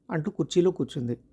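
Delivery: ordinary delivery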